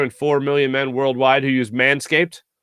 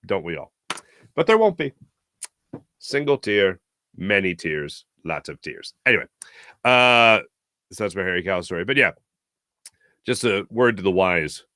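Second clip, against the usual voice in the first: usual speaking voice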